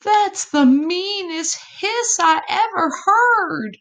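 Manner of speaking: high, frightened possum voice; frightened gasp. A narrator's reading. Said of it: "Epossumandus gasped".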